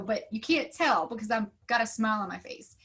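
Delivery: ordinary talk, nothing marked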